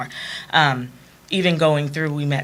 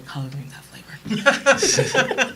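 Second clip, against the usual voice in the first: slow, higher, breathy words laughed out; mocking the boss's admission through laughter; laughing whisper; 7 semitones higher, much slower than usual. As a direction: let the laugh swallow the boss's line. Boss admits greens have flavor.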